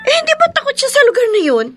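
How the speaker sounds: high, rapid disbelieving questions; alarmed questioning. Acted. Alarmed questions on hearing news. (gulat at mabilis na pagtatanong)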